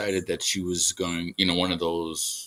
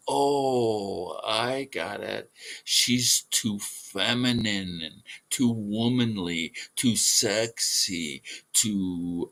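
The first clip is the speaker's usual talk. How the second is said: drawn-out sarcastic realization; mock realization; slower than usual. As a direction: stretch each reason out in mock understanding. Sarcastically answering her own question.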